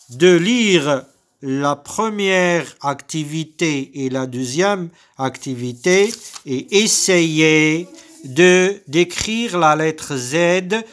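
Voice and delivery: ordinary talk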